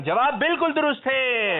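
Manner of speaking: high, slow, drawn-out shout of approval; triumphant announcement. Real, not acted. Host declares the answer absolutely right, triumphant. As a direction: proclaim it high and stretched for the crowd.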